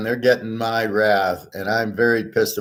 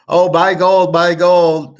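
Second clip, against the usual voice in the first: mock sales pitch; 8 semitones higher, 6 dB louder, slower than usual. Mocking the gold promoters' pitch.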